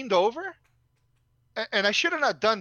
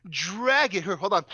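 ordinary talk, nothing marked

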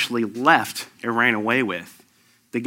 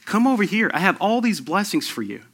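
higher, gentle beckoning voice, slowing at the end; inviting Jesus; 8 semitones higher than usual. Retold or imitated. Pastor voicing Jesus inviting the congregation, higher and gentle. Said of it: "Jesus is actually sitting right here saying".

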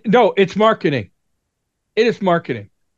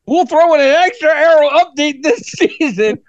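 laughing hyped ad pitch; infomercial; 6 semitones higher than usual. Acted. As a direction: throw in the bonus like an ad. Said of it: "But wait, there's more".

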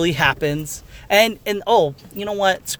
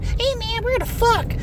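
very high, softer squeak; mock the chatty sidekick; stupid ghost voice; 4 dB quieter than usual. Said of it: "something stupid talking for you".

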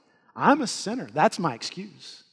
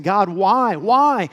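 crying out to God; 3 semitones higher, 7 dB louder, much slower than usual. Retold or imitated. Preacher voicing the psalmists crying out why. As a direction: shake a fist at heaven and cry why. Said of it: "shaking their fist at heaven and saying".

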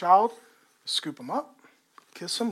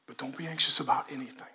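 soft whisper; 3 semitones lower, 5 dB quieter, faster than usual. Shown as a demonstration. Tender father to child.

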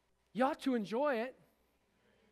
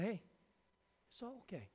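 calm, a bit patronizing; 7 dB quieter than usual. Acted. Preacher voicing the Lord answering anxious Martha, soft and patronizing. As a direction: hush her gently like a fretting child. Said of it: "And the Lord said".

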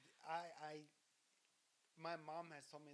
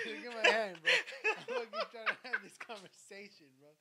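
laughing while talking; loud, laughing through words; 10 semitones higher, 15 dB louder than usual. Real, not acted. Laughing at own joke.

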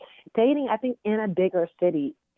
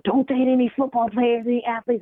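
lightly voiced warning dad, higher pitch; retold dad's warning; 6 semitones higher, 4 dB louder than usual. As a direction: warn her off, like a worried parent quoted.

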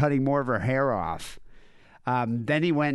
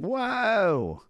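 startled whoa; 12 semitones higher than usual. Retold or imitated. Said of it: "I was saying".

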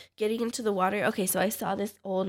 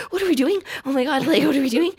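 retold panic, higher and louder; worked-up, raised-pitch retelling of panic; freaked out; 5 semitones higher, 5 dB louder, faster than usual. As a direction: blurt it out in mock alarm. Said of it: "It freaked me out".

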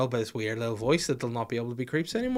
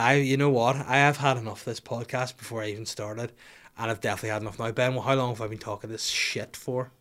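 normal talking voice

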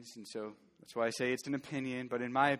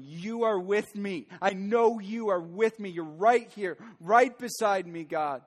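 louder, higher, clinging to every word; fervent declaration; 7 semitones higher, 6 dB louder than usual. Retold or imitated. David crying out to God in the valley, fervent and raised. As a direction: cry it out as David would, pleading and sure. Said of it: "He's going".

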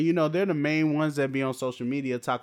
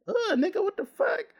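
disgusted shock. Real, not acted. Disgusted at worm drug.

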